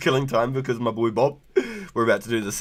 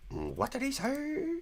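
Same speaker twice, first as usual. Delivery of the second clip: high, slow, softer squeak; softer, slower high squeak; 9 dB quieter, slower than usual. Real, not acted. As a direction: stretch it out high. Repeated higher, slower.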